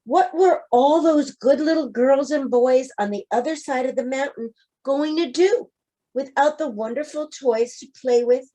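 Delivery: usual speaking voice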